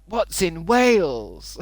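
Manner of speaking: high, slow, drawn-out question; baffled disbelief. Real, not acted. Streamer scoffing at the move-to-Wales advice, high and slow. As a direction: stretch it out as if the idea is absurd. Mocking chat's Wales suggestion.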